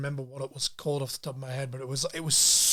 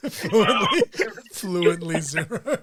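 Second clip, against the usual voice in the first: repeating through laughter, louder at first; laughing; 2 semitones higher, 6 dB louder, slower than usual. Real, not acted. Host repeats guest's joke answer, laughing. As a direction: crack up while repeating it.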